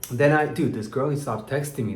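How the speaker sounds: ordinary talk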